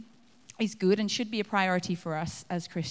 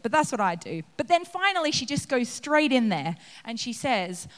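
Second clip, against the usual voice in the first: ordinary talk, nothing marked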